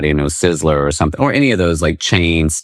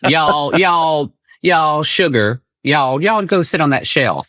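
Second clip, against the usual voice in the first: put-on higher, stretched Southern US accent with y'all drawn out; 10 semitones higher, slower than usual. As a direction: ham up a Southern auntie calling sugar. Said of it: "In the South".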